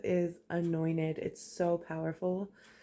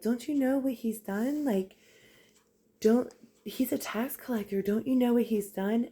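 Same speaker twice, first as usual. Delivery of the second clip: indignant disciples; 6 semitones higher, 4 dB louder than usual. Retold or imitated. Disciples protest Jesus choosing Matthew.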